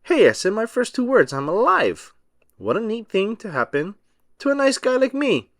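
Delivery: high, excited snowman voice; delighted Frosty. A narrator's reading. Frosty to the children, thrilled to be alive, high and excited. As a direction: bubble over at being alive.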